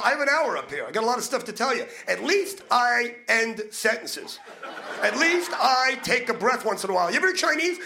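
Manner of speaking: ordinary talk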